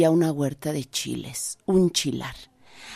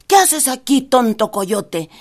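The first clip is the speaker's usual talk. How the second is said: louder, then slow on tonto coyote; annoyed farmer; 6 semitones higher, 5 dB louder, slower than usual. Acted. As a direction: scold the dumb coyote.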